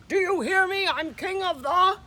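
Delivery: high, loud bullying snarl. A narrator's reading. Big Bark demands the crowd hear him, high loud snarl. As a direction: bellow it down at the crowd. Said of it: "he snarled".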